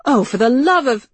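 high, loud exasperation; exasperated outburst. Acted. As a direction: burst out in exasperation.

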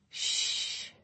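gentle whispered hush; soft shush. Acted. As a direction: hush her tenderly. Soothing her confused grandmother.

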